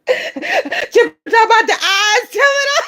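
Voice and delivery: words squeezed out high through laughter; laughing. Real, not acted. Streamer repeats Chipper's line while cracking up laughing. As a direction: talk while cracking up, pitch way up.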